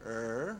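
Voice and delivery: "Er" held with the tongue curled back; R sound demo. Shown as a demonstration. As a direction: model "Er" clearly, tongue curled. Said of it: "try this for me".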